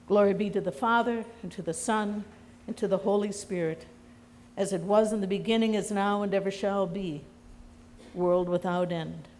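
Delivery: quick, rote recited prayer; liturgical doxology. Acted. Preacher closes sermon with congregation, quick recited prayer. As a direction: run through it like a familiar liturgy.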